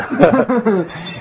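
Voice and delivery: laughing. Real, not acted. Joking about boy band.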